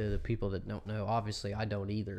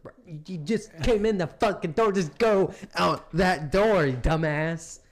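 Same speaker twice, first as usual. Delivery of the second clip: high, loud exasperated yell; 6 semitones higher, 6 dB louder than usual. Retold or imitated. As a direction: yell it at the dumb buddy. Retelling scene, other character yelling.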